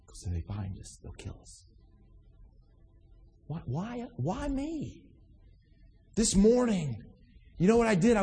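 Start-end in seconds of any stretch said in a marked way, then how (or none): 0.1-1.6 frightened whisper, barely voiced; hushed whisper. Pretend hiding believers whispering. 3.5-5.0 hushed, pleading why me; soft plaintive why me. Pretend. Softly repeated question.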